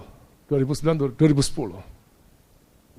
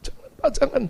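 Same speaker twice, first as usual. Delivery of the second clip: high, imitated pleading; acted plea; 13 semitones higher, faster than usual. Pastor protests at the foot washing, acted plea. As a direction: beg him to stop, in a much higher voice.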